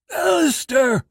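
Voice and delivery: high, loud choked cry; dying man crying out a name mid-seizure. Acted.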